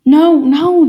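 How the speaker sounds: loud, high protest; arguing. Acted. Joseph protests the surrender, loud and high. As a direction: cry out in protest. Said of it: "Joseph wanted to argue".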